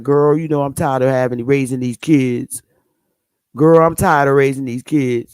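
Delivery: put-on low, weary girlfriend gossip voice, lightly done; a hint of a tired mama monkey complaining; voicing a mom monkey. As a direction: sigh it out like a tired mother chimp. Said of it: "making her lips like".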